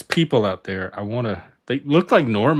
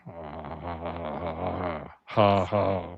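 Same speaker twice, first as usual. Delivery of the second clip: robot laugh; 5 semitones lower, 9 dB quieter, much slower than usual. Shown as a demonstration. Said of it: "You have to go like".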